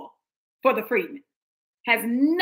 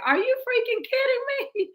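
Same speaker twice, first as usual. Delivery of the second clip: much higher, squeaky mock disbelief; imitating the sister's disbelief; 13 semitones higher than usual. Voicing the sister walking off stage, high incredulous. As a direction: throw it up high like she can't believe it. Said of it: "the sister's reaction was like".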